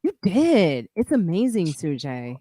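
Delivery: usual speaking voice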